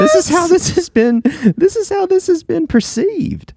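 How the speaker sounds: high squeaky mock voice. Mock voicing listeners' view.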